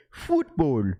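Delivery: "Football" with a long oo vowel, bright and clipped at the end. Shown as a demonstration.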